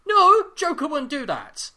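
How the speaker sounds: loud, high indignant protest; indignant outburst. Real, not acted. Fan objecting to the Joker storyline, loud and high.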